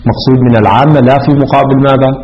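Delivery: normal talking voice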